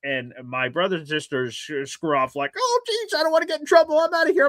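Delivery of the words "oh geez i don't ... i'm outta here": much higher, mock-panicked kid voice; panicky siblings. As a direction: squeak it out like scared kids fleeing.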